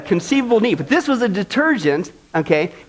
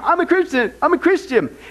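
higher, smug voice; sing-song self-assured boast; mock claim; 8 semitones higher than usual. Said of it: "If we say that we have fellowship".

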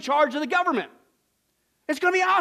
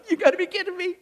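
God laughing; 4 semitones higher, faster than usual. Acted. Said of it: "He's splitting the gut".

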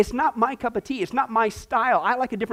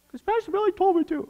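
mock sheepish answer; 11 semitones higher than usual. Voicing Tom's reply.

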